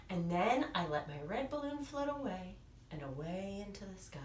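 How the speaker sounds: softly whispered float away; fading to whisper. Acted. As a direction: trail off into a hushed whisper.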